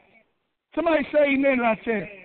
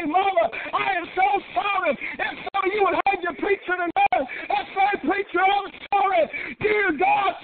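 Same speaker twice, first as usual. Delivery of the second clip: full-force preaching; 5 semitones higher than usual. Acted. Preacher pleading with the church to forgive, shouting. Preacher builds to altar call.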